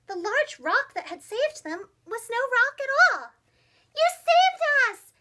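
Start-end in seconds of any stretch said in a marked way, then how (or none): 3.9-5.1 high, loud grateful shout. Acted. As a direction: cry out in relief to the toad. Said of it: "shouted the frogs".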